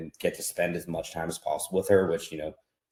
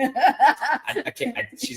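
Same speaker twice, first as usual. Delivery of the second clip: laughing; 6 dB louder, much slower than usual. Real, not acted. Laughing refusal.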